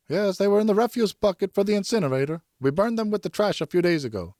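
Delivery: a servant casually reporting the boots were burned; servant's reply. Acted. Servant answers about burned boots.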